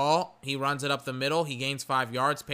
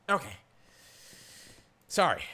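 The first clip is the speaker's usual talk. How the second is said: normal talking voice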